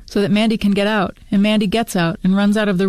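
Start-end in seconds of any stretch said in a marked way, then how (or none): none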